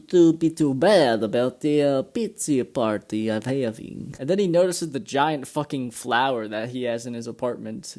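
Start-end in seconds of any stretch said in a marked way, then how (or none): 0.0-4.2 slow, halting put-on accented voice; Christoph Waltz. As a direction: impersonate a casually apologetic foreign party host. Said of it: "Christoph Waltz comes in to carry".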